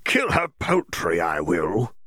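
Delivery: a spiteful little beast grumbling its threat; creature muttering. Acted. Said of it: "the Jarvie muttered".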